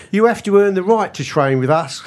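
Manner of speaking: a light mock Cockney manager's voice; Eddie Howe in Cockney. Quoting the Newcastle manager to Kev in light Cockney. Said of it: "shall I do an accent? Is he a Cockney?".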